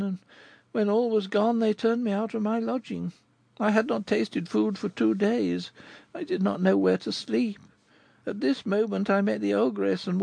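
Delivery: put-on high, girlish voice telling her sad tale; young girl Goualeuse. Goualeuse tells Rodolphe her story, in a high girlish voice. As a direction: speak as the poor young girl recalling her past.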